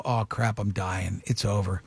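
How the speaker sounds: low, sinking mock doom; resigned dread. Man seeing red in the toilet, resigned. Retold dread at blood in bowl.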